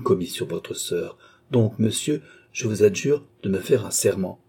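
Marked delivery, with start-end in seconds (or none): none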